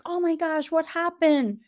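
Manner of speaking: high, startled gasp of concern; alarmed adult. Retold or imitated. Teacher imitating adults rushing to a bitten child: high, alarmed concern. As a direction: rush in, shocked and worried.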